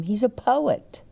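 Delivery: plain reading voice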